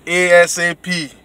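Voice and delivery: usual speaking voice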